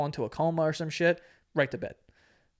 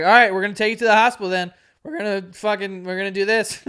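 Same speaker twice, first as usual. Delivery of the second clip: loud, fast, fed-up mother; retold exasperated threat; uptight mom; 4 semitones higher, 8 dB louder than usual. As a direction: imitate a mom calling a kid's bluff. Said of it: "His mom was tight".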